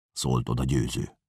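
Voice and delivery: ordinary delivery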